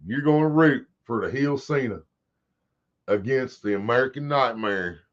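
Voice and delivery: normal talking voice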